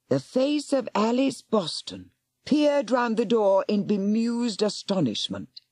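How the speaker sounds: usual speaking voice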